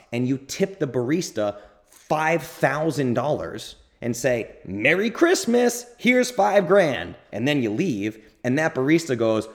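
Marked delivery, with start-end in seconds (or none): 4.6-7.2 cheery holiday giver. Retold or imitated. Mock generous tipper quote.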